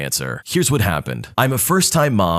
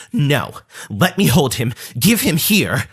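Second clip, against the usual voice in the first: slow, pushy mock cousin, rising on give him here; aggressive demand; 4 semitones higher, slower than usual. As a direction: grab for the baby with your voice. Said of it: "gets pretty aggressive at this point and goes".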